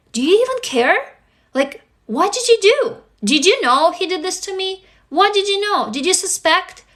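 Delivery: hurt, urgent questioning; accusing confrontation. Acted. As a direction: demand answers from the parent who failed you. Imagined confrontation with mother.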